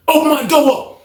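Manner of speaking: high-pitched demand called through a door; calling out. Retold or imitated. Inmate calling for his cell to open, high holler.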